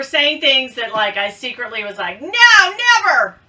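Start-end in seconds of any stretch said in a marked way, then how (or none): 2.2-3.4 high, loud mock protest; mock refusal. Vlogger voicing her old opinion of the bags, loud mock refusal. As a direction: cry out in pretend horror.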